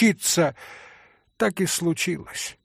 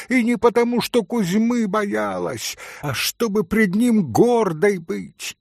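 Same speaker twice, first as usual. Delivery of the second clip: proud furious outburst; 7 semitones higher, 4 dB louder than usual. Acted. Грушенька гордо кричит.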